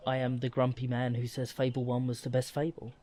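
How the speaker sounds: normal talking voice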